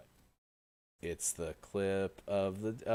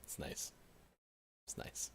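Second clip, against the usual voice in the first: whispering; 8 semitones lower, 16 dB quieter than usual. Real, not acted. Soft reaction to game title.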